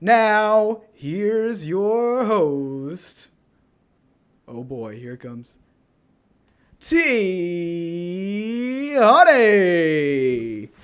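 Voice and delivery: slow, drawn-out host announcement; game show announcer. Acted. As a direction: announce it like a showman.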